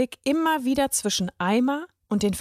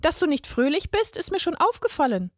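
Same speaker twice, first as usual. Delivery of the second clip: put-on high, sing-song little girl; fluting; 8 semitones higher than usual. A narrator's reading. Girl teases the grumpy boy in a high fluting voice. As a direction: pipe it up sweetly like a teasing girl. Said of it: "flötete die Kleine".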